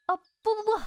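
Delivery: sudden high, loud burst of no no no; flustered no no no. Acted. Yun hastily denying the sect leader's crude guess, startled and loud. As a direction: jump in fast to deny it.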